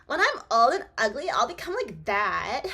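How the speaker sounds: put-on mean girl voice. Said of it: "I'll give her a mean girl voice".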